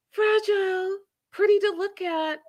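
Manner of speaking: high, dainty mock cooing; teasingly precious, pitched well up; mock fragile. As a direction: say it like describing a delicate little bird. Mocking her as fragile bird.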